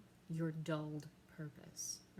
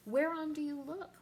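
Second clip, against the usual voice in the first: higher and louder, a frightened mother's question; alarmed cry; 8 semitones higher, 9 dB louder than usual. Acted. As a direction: call out in alarm. Gertrude alarmed at Hamlet.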